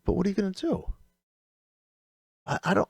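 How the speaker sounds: normal talking voice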